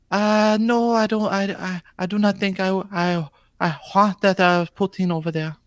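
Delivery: bad French Canadian imitating Wing; Wing impersonation. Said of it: "I tried to sound like Wing".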